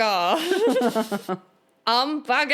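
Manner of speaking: mock German accent. Mimicking the hamburger man.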